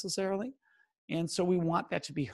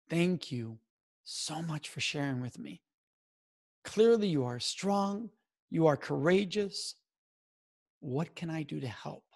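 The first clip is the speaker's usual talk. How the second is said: simple, sincere support; 2 semitones lower than usual. Shown as a demonstration. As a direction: gently reassure a survivor, unhurried. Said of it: "and simply say".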